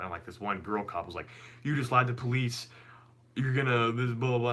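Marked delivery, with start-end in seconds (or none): 1.6-4.5 higher-pitched imitation of a reproachful officer; scolding female cop. Cop catching him lying to police, higher scolding voice. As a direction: mimic her telling-off, pitched up.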